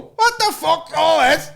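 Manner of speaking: high, slow Scouse astonishment; Scouse accent. Acted. Imagining a fighter jet pilot, hammed-up Scouse accent. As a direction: put on a high-pitched Scouse voice. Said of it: "you'll never get a Scouser".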